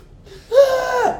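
loud, high shocked gasp; acted yelp of cold shock; cold-shower gasp. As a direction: gasp as if icy water just hit you.

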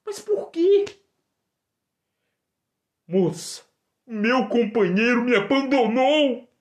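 high, distressed female voice; high woman's voice. Acted. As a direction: play the abandoned woman, high.

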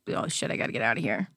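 lightly voiced cop slipping away; retold cop. Cop muttering to himself after the crash, low. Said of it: "he was just like".

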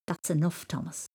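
plain reading voice

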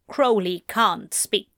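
plain reading voice

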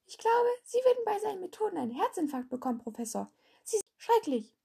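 viel höhere, schnelle Kinderstimme; kleinster Schüler. A narrator's reading. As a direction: klingt wie ein kleines Kind, hoch und hastig. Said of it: "der kleinste ergänzte". (kleiner Schüler beschwert sich, hoch und schnell)

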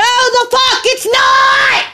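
shouting back, slow and high; angry shout. Real, not acted. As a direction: yell it in outrage. Angry retort to Carol.

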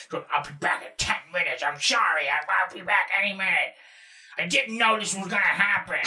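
put-on voice of a hapless friend; bad roommate. Roommate texting his mom apologies, imitated in silly voice. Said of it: "doing the Marshall fucking bad roommate voice".

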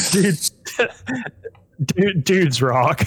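slow, drawn-out meme line; meme quote. Pretend. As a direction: stretch it out like the meme. Quoting a meme.

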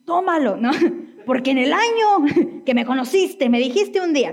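reproche agudo y burlón, a punto de reír; réplica acusadora fingida. Acted. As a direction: saca el reproche guardado como una carta ganadora, en broma. Responde al esposo sacando trapos viejos. (reproche exagerado en broma)

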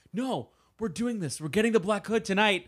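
mock heroic resolve. Podcaster acting out Archie insisting on the mission, mock resolute. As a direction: declare it with hammed-up determination.

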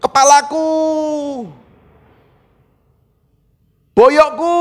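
hammed-up cry over an aching body; mock cry of pain. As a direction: wail it, stretching the last syllable. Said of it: "Kenapa kok nggak bilang gini".

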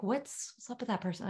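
softly murmured bewildered remark; hushed aside. Acted. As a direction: say it under your breath, as a puzzled onlooker. Onlookers muttering about odd speaker.

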